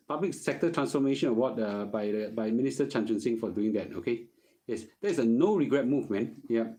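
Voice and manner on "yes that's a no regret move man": normal talking voice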